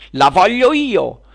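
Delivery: high, loud, drawn-out demand; quarrelling. Acted. Witch claims the sphere for herself, quarrelling. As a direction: wail it like a child grabbing a toy. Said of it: "iniziarono a litigare tra loro".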